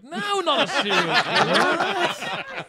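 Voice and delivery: high, slow, laughing protest; laughing exasperation. Retold or imitated.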